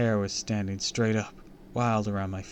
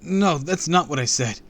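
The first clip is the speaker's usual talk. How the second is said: higher, louder denial; anxious protest; 7 semitones higher, 7 dB louder than usual. A narrator's reading. Kid correcting the raging villain, anxious protest. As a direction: protest urgently against the accusation.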